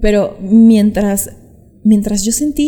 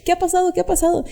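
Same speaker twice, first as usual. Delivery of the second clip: urgent worried asking; 7 semitones higher, faster than usual. Retold or imitated. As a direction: press her for an answer, a little alarmed. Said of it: "me pregunta y me dice".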